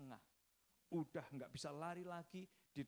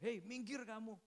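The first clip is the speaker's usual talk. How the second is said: acted anger in a raised pitch; angry tiger; 6 semitones higher than usual. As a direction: warn off a rival like a possessive tiger. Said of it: "marah harimaunya, saudara".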